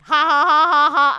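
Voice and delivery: loud mocking ha-ha laugh; imitated weird laugh. Imitating Jeff Bezos's weird laugh after space, loud mock laugh. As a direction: ham up a billionaire's odd laugh. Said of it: "His, like, weird laugh".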